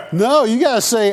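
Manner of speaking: ordinary talk, nothing marked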